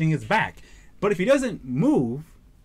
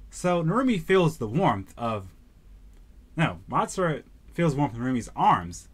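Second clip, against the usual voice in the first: ordinary talk, nothing marked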